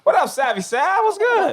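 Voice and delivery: high, playful hype greeting; excited greeting. Real, not acted. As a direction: hype up your co-host.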